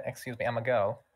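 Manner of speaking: ordinary delivery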